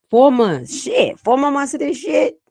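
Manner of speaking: loud, high exasperated outburst; getting mad. Real, not acted. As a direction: blow up in frustration. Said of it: "I'm sorry I'm getting mad".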